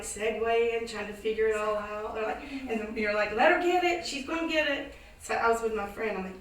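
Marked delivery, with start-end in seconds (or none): 3.3-4.9 cheering call. Retold or imitated. Neighbours cheering her on.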